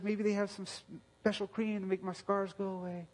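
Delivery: a hint of a hurting child saying she hates herself; daughter's voice.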